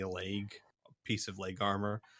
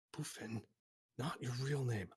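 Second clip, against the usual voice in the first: quiet correction; 10 dB quieter than usual. Acted. Another wolfen corrects her quietly.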